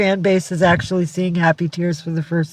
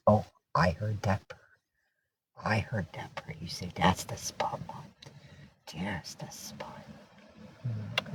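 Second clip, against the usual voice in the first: very soft, low pet talk; hushed cooing; 7 semitones lower, 15 dB quieter than usual. Real, not acted. Owner scratching her purring cat, hushed and tender. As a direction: murmur it low, slipping into a whisper.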